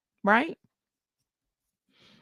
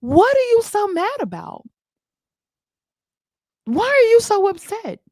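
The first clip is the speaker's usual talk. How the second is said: incredulous; 9 semitones higher than usual. Real, not acted. Incredulous questions.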